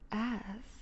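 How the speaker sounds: a teasing breathed final word; inviting the captive to ask for a taste; whisper. Acted. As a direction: let the last word dissolve into breath. Seductive invitation.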